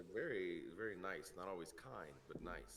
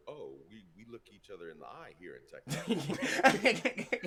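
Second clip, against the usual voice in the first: laughing; 3 semitones higher, 17 dB louder, slower than usual. Retold or imitated. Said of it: "I have to remember".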